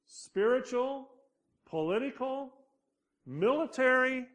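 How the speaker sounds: each word pitched high and pressed; emphatic list. Real, not acted. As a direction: hit every word of the list hard. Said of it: "builds the list emphatically".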